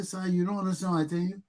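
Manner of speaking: ordinary talk, nothing marked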